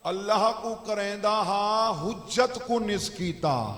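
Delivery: loud, declamatory sermon voice; forceful preaching. Real, not acted. Preacher telling the gathering whom Satan refused, loud and forceful. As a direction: drive the point home at full voice.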